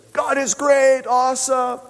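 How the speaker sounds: high, drawn-out cheer; mock celebrating. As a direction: cheer like a crowd partying. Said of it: "got to celebrate a little bit".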